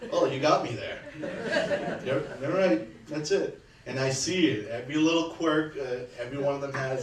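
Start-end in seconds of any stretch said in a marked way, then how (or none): 0.1-3.6 slow, high, laughing "you got me"; Dad laughing as he admits defeat; laughing concession. Retold or imitated. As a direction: give in through a laugh.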